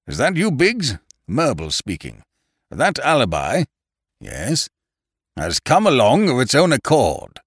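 loud, high-pitched telephone talk; telephone call. A narrator's reading. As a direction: call news down the phone line. Character speaking on a telephone.

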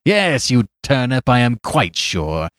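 loud gloating triumph; triumphant cry. Acted. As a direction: crow over the beaten priest. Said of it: "he cried".

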